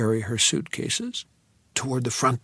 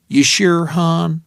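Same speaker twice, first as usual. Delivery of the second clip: high, drawn-out Texas waitress; thick Texas accent, friendly waitress; 5 semitones higher, 6 dB louder, slower than usual. A narrator's reading. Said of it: "in a Texas accent that was thick enough to cut".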